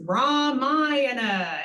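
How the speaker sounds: "Ramayana" as RAA-MAA-yana, long vowels drawn out; stress on long sounds. Shown as a demonstration. Teacher correcting mispronounced words, long-vowelled "Ramayana". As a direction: slowly stretch the first two vowels of "Ramayana". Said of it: "emphasis on the long sounds".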